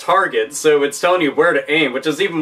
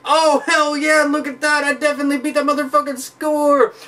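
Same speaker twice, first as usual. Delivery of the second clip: gloating; 10 semitones higher than usual. Pretend. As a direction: crow over the new high score. Player gloats over high score.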